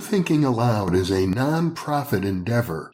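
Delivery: announcer read. Acted. Host reading the foundation appeal, steady announcer read. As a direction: read it like a sponsor message.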